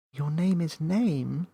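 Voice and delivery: put-on slow, high disbelieving girl; can't believe it; incredulous Millie. A narrator's reading. Millie voiced.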